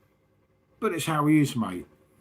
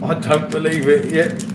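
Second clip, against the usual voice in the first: Victor catchphrase; 4 semitones higher, 8 dB louder than usual. Retold or imitated. Does the sitcom catchphrase.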